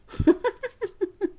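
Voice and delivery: high squeaky burst of laughter; a high giggle at the creepy card; shrill little laugh. Real, not acted.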